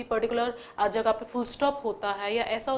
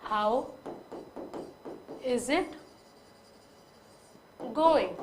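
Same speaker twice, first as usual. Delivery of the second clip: How, is it, going with long pauses between; split word by word; much slower than usual. Shown as a demonstration. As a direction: pause after each word. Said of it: "खोल के लिखूँ". (हर शब्द के बाद रुककर "How is it going?")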